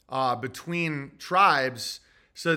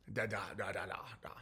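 put-on sleepy kid mumble; 10 semitones lower, 16 dB quieter, much faster than usual. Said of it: "And then Jack's like".

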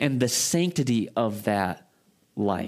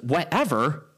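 mock outraged, pitch jumping up; a lightly voiced uptight guy taking offense; offended disbelief; 7 semitones higher than usual. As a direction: repeat the word in offended disbelief. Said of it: "the guy that's a little uptight".

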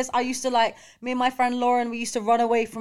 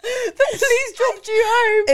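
much higher, louder and slow, half laughing; drawn-out shocked squeal; stunned disbelief; 12 semitones higher, 5 dB louder, slower than usual. Real, not acted. Reacting to police story.

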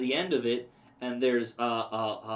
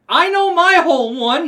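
high, loud exasperated retort; indignant shout; 15 dB louder than usual. Retold or imitated. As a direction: fire it back, fed up and incredulous. Arguing with companion at counter.

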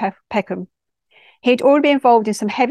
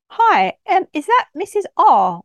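noticeably higher-pitched telephone greeting; polite phone voice; 9 semitones higher, 4 dB louder, slower than usual. Acted. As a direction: put on your polite caller voice. Voiced phone call to boy's mother.

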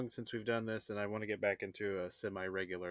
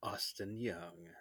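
sultry narrator; 2 semitones lower, 6 dB quieter, much slower than usual. Acted. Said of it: "coming in with that sultry, sultry voice".

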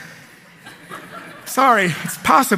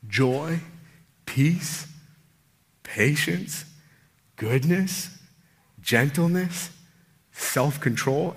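soft preaching appeal; 6 semitones lower, 6 dB quieter than usual. Acted. Soft, intimate appeal to congregation.

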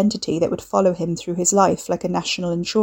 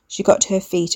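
usual speaking voice

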